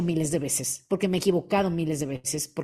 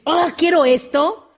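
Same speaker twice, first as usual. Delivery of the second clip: louder, higher, slow excited longing; eager wish; 7 semitones higher, 6 dB louder, slower than usual. Retold or imitated. A listener suddenly wanting something, excited and slow. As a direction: blurt it out like a sudden dream.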